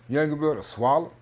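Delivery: normal talking voice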